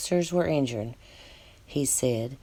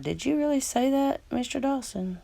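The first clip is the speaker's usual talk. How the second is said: incredulous; 8 semitones higher than usual. Real, not acted. Mocking question to the gunman.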